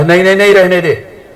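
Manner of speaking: sudden high, loud protest; hasty refusal. Acted. Mother-in-law stops the daughter-in-law from taking the food, high and loud. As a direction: jump in to stop her taking the bhajiya.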